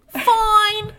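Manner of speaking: very high falsetto answer; high-pitched comic character; Marie's squeaky voice. Acted. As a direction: chirp it in a put-on squeak.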